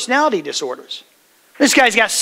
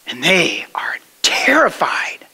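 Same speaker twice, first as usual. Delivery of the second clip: breathy hushed whisper, lower; 5 semitones lower, slower than usual. A narrator's reading. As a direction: let the fear hang in a whisper. Storm scene, disciples terrified.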